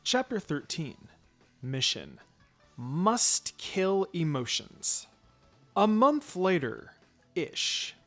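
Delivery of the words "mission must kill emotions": ordinary delivery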